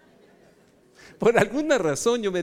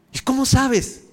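high, loud mock demand; challenging retort; 8 semitones higher, 7 dB louder, slower than usual. Pastor retelling how he challenged the brother, incredulous. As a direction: throw the challenge back, raised and louder.

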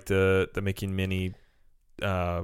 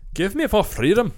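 much higher, louder hammed-up Scottish accent; mock Scottish battle cry; 12 semitones higher, 6 dB louder than usual. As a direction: shout it like a Scottish rebel, for laughs. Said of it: "I can do a Scottish accent".

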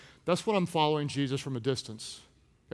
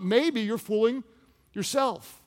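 ordinary talk, nothing marked

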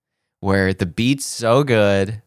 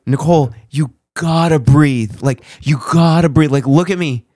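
loud, raised re-enactment of coaching someone; urgent coaching; 5 semitones higher, 9 dB louder than usual. Retold or imitated. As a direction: insist loudly, demanding their attention. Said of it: "This is how I was handling it".

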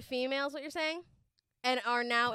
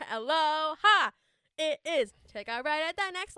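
cheery greeting; 6 semitones higher, 6 dB louder than usual. Real, not acted. Cheery show opener.

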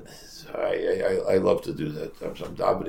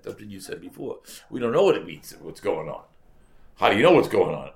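ordinary delivery

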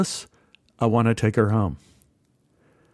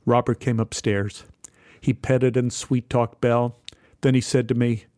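plain reading voice

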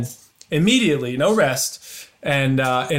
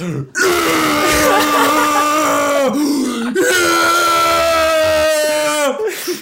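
long, loud, high-pitched imitated screams; wild celebratory screaming; 13 semitones higher, 5 dB louder than usual. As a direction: scream like a carful of ecstatic friends. Imitates friends going wild at news.

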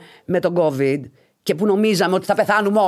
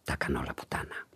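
very low, barely audible password; secretive whisper; 17 dB quieter than usual. Retold or imitated. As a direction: whisper it like a covert code. Man murmurs password at the bar.

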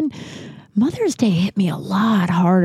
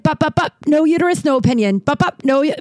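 higher, chirpy mock Rachel from Friends; playful higher imitation of a TV scold; imitating Rachel; 8 semitones higher, faster than usual. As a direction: brush them off like a sitcom character. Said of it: "Rachel on Friends? Was like".

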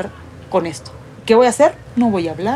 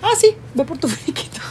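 a higher, offhand imitation of the club telling her to collect her pay; mock dismissive club official; 7 semitones higher, 3 dB quieter than usual. Player mimics the club dismissing her, offhand and higher.

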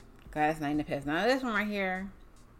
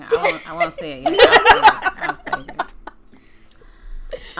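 higher, louder, giggling teaser; laughing; 14 semitones higher, 10 dB louder, slower than usual. Real, not acted. Host teasing her pick, high laughing. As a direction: laugh while holding back the answer.

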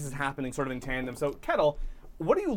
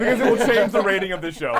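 laughing; 6 semitones higher, 8 dB louder, faster than usual. Real, not acted. Refusing through laughter.

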